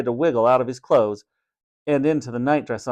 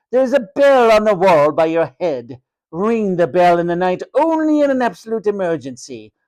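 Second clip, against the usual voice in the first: high-pitched haughty matron, crisp and stern; snappy strict matron; 6 semitones higher, 3 dB louder than usual. A narrator's reading. Matron laying down ward rules to new boy, snapped and imperious. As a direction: rattle off stern orders, swooping high. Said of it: "Her words snapped like the crack".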